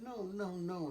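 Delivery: acted little girl refusing to accept it; upset refusal. Upset little sister refusing to drop it, protesting. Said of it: "she couldn't accept it".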